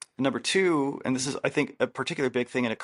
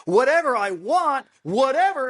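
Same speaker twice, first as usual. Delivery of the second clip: much higher, louder, slow and seething; mock angry; 13 semitones higher, 6 dB louder than usual. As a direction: spit it out, fed up. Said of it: "he gets more and more angry".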